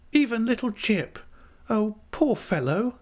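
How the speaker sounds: high-pitched, full-voiced pity; compassionate. A narrator's reading. Dora looking at her old dog Jip, compassionate. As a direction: pity him openly, pitched right up. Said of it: "looking at him with compassion".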